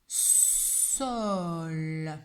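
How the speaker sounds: "Sol" slow, long s and held l; sounding out. Shown as a demonstration. Teacher shows three sounds to pupil, "Sol" stretched slowly. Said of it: "il y a également trois sons".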